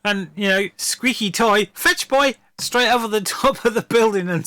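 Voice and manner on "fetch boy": higher-pitched call to a dog. Acted. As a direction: playfully send a dog off to fetch. Mimics calling werewolf like a dog.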